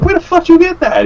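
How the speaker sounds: replayed jealous outrage; retold indignant demand. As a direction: blurt it in high-pitched disbelief. Retold indignant question to coworker.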